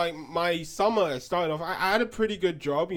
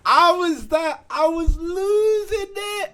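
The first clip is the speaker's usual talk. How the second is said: losing it; 11 semitones higher, 8 dB louder than usual. Retold or imitated. Retelling losing his mind.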